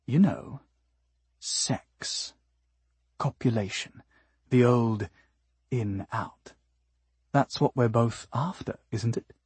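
quiet, broken up by long pauses; halting explanation. Acted. Nasuno explains her intentions to Decibel, halting and quiet. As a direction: spell it out word by word, softly.

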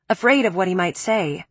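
plain reading voice

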